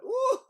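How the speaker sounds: high, loud squealing laugh; a hungry gecko cackling; gleeful gecko laugh. Acted. Gecko eyes the fly.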